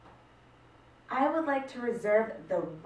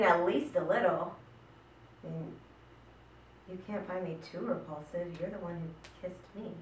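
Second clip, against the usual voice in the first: normal talking voice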